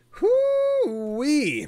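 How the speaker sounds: high loud whoop; excited exclamation. Real, not acted. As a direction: let out a whoop.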